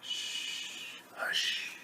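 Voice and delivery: long soft shush, then hush; whispered hush. Acted. Mother hushing animals near sleeping baby, whispered.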